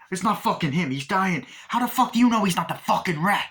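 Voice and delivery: high-pitched, rising indignation; heated argument. Acted. Robbers arguing over who is the rat, heated accusation. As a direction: build to an angry challenge.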